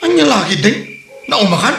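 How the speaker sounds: indignant retort. Acted. Husband throws her words back at her, indignant. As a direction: challenge her angrily.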